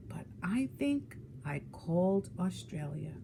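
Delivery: guilty little whisper. Acted. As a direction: whisper it like a secret confession. Child confessing a mishap.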